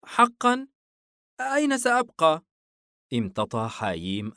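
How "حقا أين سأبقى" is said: put-on very high, louder child's voice; small boy asking in dismay; worried little boy. A narrator's reading. Son looks up asking father where he'll stay, worried child voice.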